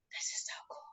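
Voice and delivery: soft unvoiced whisper of awe; awed whisper. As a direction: whisper it like it's magic. Awed whisper retelling the drive.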